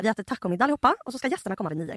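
ordinary talk, nothing marked

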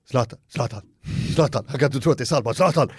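put-on fast, breathless kid calling out a name; a child who can't believe his eyes; starstruck kid. Kid starstruck at seeing Zlatan. (upphetsat barn)

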